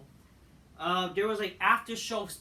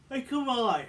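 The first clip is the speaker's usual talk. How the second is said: louder, higher burst of exasperation; exasperated, raised-voice protest; exasperated outburst; 6 semitones higher, 7 dB louder than usual. Real, not acted. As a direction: plead loudly in disbelief. Exasperated repeat while ranting.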